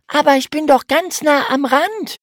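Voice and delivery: viel höhere Mädchenstimme, leicht trotzig; hoch und lauter, abwiegelnd; Mädchen erklärt. A narrator's reading. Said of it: "erklärte Emma". (Schwester beruhigt den Bruder, hohe Mädchenstimme)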